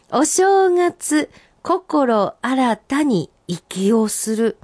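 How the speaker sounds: senryu recital. Acted. First reading, recited high and slow.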